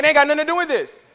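high-pitched indignant animal voice; protesting livestock. Acted. Preacher speaks for the blameless livestock, high protest. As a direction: protest like a dragged-in bystander. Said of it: "They're like".

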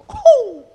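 high, loud "ooh" whoop; preacher's whoop. Real, not acted. Preacher reacting to the jealous God verse, high whoop. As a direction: let out a sudden high whoop.

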